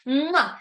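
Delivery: high "mwah" kiss noise; blown kiss. Real, not acted. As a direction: smack a kiss to the listeners.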